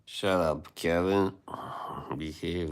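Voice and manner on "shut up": normal talking voice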